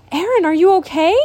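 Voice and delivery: high, loud, quick worried call; anxious cry. A narrator's reading. Emily bursting in to find Aaron, worried cry. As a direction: rush in calling his name.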